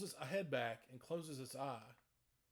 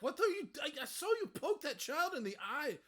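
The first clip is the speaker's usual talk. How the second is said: much higher, louder horrified accusation; outraged mother cries out, much higher and louder; Kretyana; 13 semitones higher, 7 dB louder than usual. Acted. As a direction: shriek at him for hurting the child. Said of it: "Kretyana is gonna be like".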